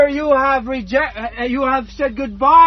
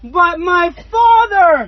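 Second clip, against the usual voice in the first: slow, drawn-out preaching cry, a touch louder; 3 semitones higher, 6 dB louder, much slower than usual. Acted. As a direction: stretch out my Father like a calling preacher. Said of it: "he's crying out with a loud voice".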